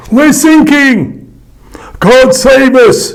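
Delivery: high, loud, slow panicked cry; terrified cry. A narrator's reading. Sailor in the storm crying out in terror. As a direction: cry out in terror as the boat sinks. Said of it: "cried another".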